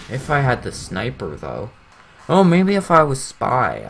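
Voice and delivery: ordinary talk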